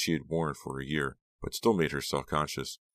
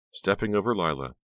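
ordinary delivery, nothing marked

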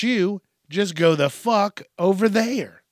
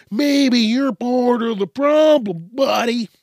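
higher, louder and slower, a taunting repeat; mocking jab; 6 semitones higher, 5 dB louder than usual. Real, not acted. To critics, repeating the point as a taunting dig. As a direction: rub it in like a smug dare. Repeats line, pushing it at viewer.

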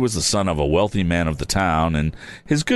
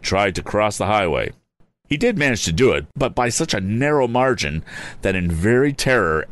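ordinary delivery, nothing marked

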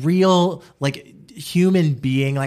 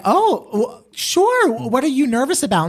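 surprised; 10 semitones higher than usual. Retold or imitated. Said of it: "And we were like".

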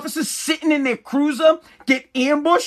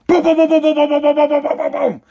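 loud rapid booms, higher, fading out; gunshot imitation; 3 semitones higher, 4 dB louder, faster than usual. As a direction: fire off the shots with your mouth. Imitating gunshots firing at shooter.

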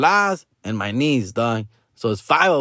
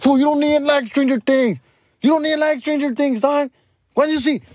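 scolding; 13 semitones higher than usual. Retold or imitated. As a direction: shut down the pricey request. Scolding the little one.

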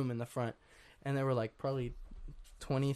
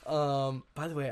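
usual speaking voice

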